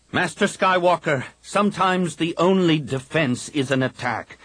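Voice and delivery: Kip's fervent voice. A narrator's reading. Kip urging the Jedi council to fight, insistent. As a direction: preach the fight, every word pressed.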